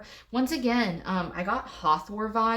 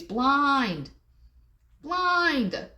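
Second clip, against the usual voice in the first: emphatic exclamation; 7 semitones higher, 4 dB louder than usual. Real, not acted. Exclaims about the card's figure.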